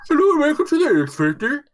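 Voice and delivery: high, hammed-up game show host welcome; mock TV host. Mocking the X Factor to friends, hammed-up high host voice. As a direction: announce it like a cheesy talent show host.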